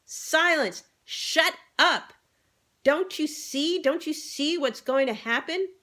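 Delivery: high loud squealing commands; urgent squealing shouts. A narrator's reading. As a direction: shout them all down, high-pitched. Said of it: "squealed Freddie".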